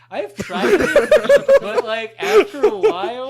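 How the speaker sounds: talking through big laughs; can barely get it out laughing. Real, not acted.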